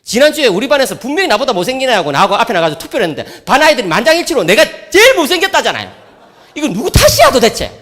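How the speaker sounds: put-on high, loud, laughing teen complaint; whiny son. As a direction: wail it like a sulky teenager. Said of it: "아빠니까 그렇게 말해주는 거지". (높고 크게 떼쓰는 아들 흉내)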